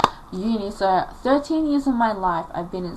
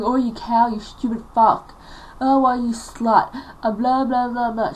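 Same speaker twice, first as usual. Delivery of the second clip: loud, imitated name-calling; mock abusive mother; 3 semitones higher, 6 dB louder than usual. Mother calls her daughter names, mock angry scolding. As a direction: hurl insults as the mother, voice raised.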